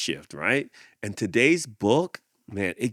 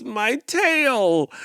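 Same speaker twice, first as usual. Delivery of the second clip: pained snake; 7 dB louder than usual. Acted. Elephant sits on snake.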